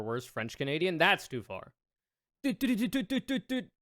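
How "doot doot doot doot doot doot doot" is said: high, fast squeaky streamer doot noises; xQc impression. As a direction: bounce the doots up high like xQc. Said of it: "That's my best X Q C impression".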